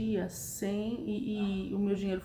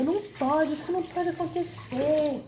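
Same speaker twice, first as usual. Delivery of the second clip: higher-pitched imitation of an upset coworker; mock dismay in a raised voice; very bothered; 6 semitones higher than usual. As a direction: retell her protest, pitched up and distressed. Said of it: "ela tava muito incomodada".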